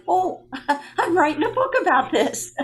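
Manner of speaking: high-pitched, laughing disbelief; amazed realization. Retold or imitated. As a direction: re-enact a giddy sudden realization. Retells realizing she is writing book.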